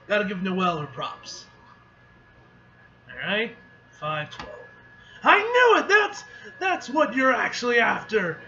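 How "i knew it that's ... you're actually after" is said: high, loud triumphant cry; excited outburst. Acted. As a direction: shout it like you caught them out. Character voice reading game dialogue.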